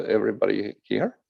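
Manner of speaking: ordinary talk, nothing marked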